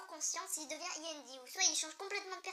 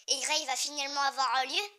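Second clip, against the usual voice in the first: ordinary talk, nothing marked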